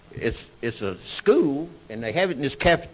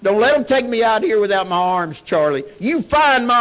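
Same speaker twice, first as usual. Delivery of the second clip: wounded man hollering; 8 semitones higher, 7 dB louder than usual. Retold or imitated. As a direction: beg for your arms at the top of your lungs. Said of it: "that's hollering".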